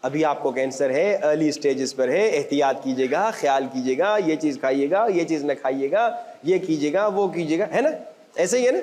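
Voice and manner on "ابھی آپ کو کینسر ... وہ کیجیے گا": low, measured reassuring advice; calm doctor. Acted. Doctor advises a newly diagnosed patient, calm. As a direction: gently list instructions in a lowered pitch.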